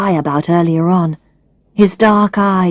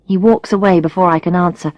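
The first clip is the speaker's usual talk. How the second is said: ordinary delivery, nothing marked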